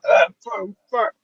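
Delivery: hammed-up frustrated rugby mate; imitating frustrated friend. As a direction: play the flustered captain who can't find the words. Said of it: "he would just be like".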